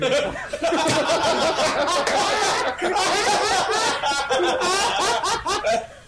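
high helpless laughing fit; can't stop laughing mid-story; loud burst of laughter. Real, not acted.

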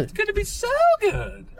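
very high, gleeful squeal of anticipation; giddy excitement. Real, not acted. Co-host echoing hype for the match, giddy. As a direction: squeal it like an eager fan.

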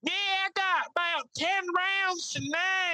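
score the fight as the country friend; Mike's voice. Retold or imitated. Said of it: "he's like".

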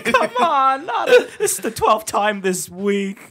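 put-on high, laughing Brooklyn accent complaint; fed-up Brooklyn accent through laughter. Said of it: "the liver as, like, some Brooklyn accent guy".

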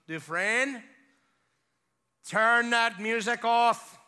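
loud, slow imitation of the warden; warden's shouted order. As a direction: bellow it word by word as the warden. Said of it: "like this".

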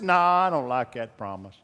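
lightly voiced dismissive aside, dropped low; mock Holy Ghost refusal. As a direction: toss it off as a sulky refusal. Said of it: "How's he gonna dis".

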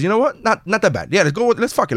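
wife caving in, pitched high. Retold or imitated. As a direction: voice her giving in, high. Wife voiced giving in.

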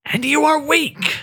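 high-pitched eerie cry of scorn; shrieking mockery. The bodiless voice mocks Gala after its head pops, high shrieking scorn. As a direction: spit the last word out from everywhere at once.

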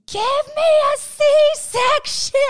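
high, loud drawn-out scream. Retold or imitated. Telling of a lady giving birth, screaming at the top of her lungs. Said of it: "screaming at the top of her lungs".

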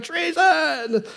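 a hint of the panicked queen; mock cry of treason. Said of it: "I can't do a female voice".

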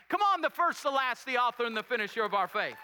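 rising high and loud on "Come on"; shouted preacher's climax; preaching at full force. Real, not acted. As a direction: burst out in praise, full voice. Preacher peaks in praise.

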